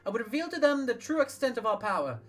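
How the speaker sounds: impassioned leader. Acted. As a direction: lift it into a rallying speech.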